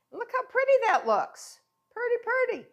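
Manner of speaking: high, bright sing-song admiration; gushing with pride, pitched high; delighted. Real, not acted. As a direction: coo over the pretty crust. Admiring finished crimped crust.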